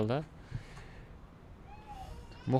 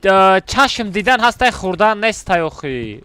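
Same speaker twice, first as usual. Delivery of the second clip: loud exasperated arguing; heated shouting; 8 semitones higher, 10 dB louder than usual. Real, not acted. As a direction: shout it out, annoyed. Heated argument with friend.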